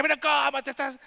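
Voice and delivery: high, loud, overdone concern; mock fussy question. Preacher mocking a clumsy therapist question, high and loud. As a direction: play a flustered counselor. Mock of what God doesn't say.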